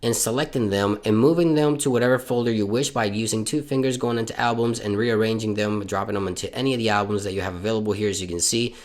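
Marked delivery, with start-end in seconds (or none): none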